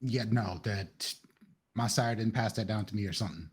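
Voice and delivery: ordinary talk, nothing marked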